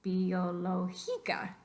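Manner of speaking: "Biológica" drawn out slowly, stressed on GI; misplaced stress. Shown as a demonstration. Teacher testing the rule, slow Biológica wrongly stressed on GI. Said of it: "cae en la penúltima sílaba".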